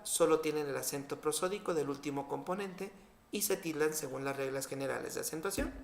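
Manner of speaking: normal talking voice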